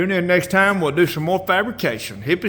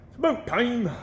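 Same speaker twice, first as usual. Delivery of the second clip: put-on high, soft Canadian accent; playful Canadian, pitched way up; mock Canadian accent; 8 semitones higher, 5 dB quieter than usual. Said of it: "I can't sound Canadian".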